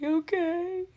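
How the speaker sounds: high, loud mock whimper; timid agreement. Patient agrees to a washcloth over the eyes, mock whimper. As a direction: squeal a tearful-sounding consent. Retold frightened agreement to staff.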